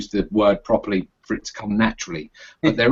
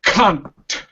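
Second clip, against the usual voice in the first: Scottish accent; 5 semitones higher than usual. Shown as a demonstration. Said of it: "two examples ... in both accents".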